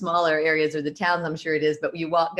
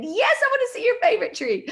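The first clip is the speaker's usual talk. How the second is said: much higher, louder eager reply; eager yes; 5 dB louder than usual. Retold or imitated. Answering the park worker about his tree, high and eager. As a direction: gush back like a delighted kid. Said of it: "And I said".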